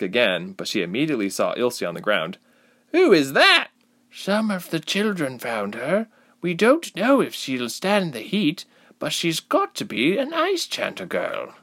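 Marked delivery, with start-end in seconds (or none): 2.9-3.7 loud, very high demand; demanding question. A narrator's reading. Girl demands to know of crowd. 4.1-11.5 answering villager. A narrator's reading. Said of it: "one voice answered".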